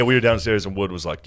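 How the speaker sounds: normal talking voice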